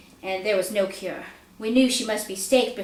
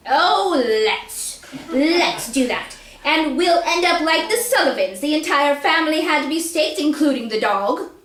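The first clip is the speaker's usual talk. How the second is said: mock agreement, a touch louder and higher; sarcastic father; 6 semitones higher, 5 dB louder than usual. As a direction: scoff at her idea as the father. Father's sarcastic reply to mother.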